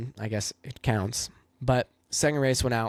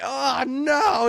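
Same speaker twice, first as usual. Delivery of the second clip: much higher, louder mock groan of dismay; 13 semitones higher, 4 dB louder than usual. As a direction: ham up the young driver's horror. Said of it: "I'm like".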